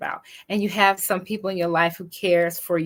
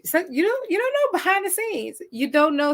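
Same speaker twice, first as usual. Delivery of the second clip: emphatic high plea; 10 semitones higher than usual. Real, not acted. Stressing the hidden struggle.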